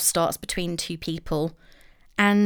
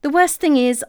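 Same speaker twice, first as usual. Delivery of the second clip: usual speaking voice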